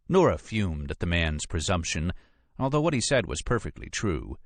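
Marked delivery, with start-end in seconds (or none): none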